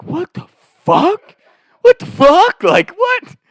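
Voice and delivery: high-pitched mock disbelief; drawn-out, squeaky disbelief; incredulous. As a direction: imitate stunned, baffled opponents. Acts out stunned opponents.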